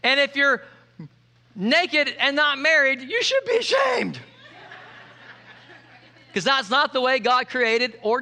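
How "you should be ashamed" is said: high-pitched scolding cry; mock scolding. Preacher scolding the naked and unmarried, high mock-outraged shout.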